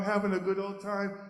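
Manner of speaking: ordinary delivery, nothing marked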